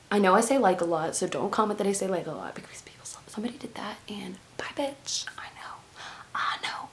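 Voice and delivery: hushed aside to the viewers; whispered side comment. Real, not acted. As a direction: drop to a whisper, confiding.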